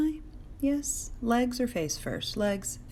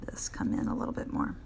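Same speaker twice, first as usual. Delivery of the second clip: whisper; 6 dB quieter, faster than usual. Real, not acted. Drawing, whispering to herself.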